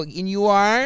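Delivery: pointed question. Retold or imitated. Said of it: "when I go".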